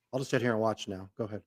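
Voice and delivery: usual speaking voice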